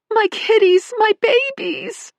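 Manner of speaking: high, loud anguished cry; frantic wail. Acted. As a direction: wail after the lost kitties. Owner chasing escaped cats.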